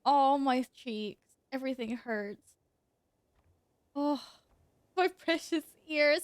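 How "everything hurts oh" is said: ordinary delivery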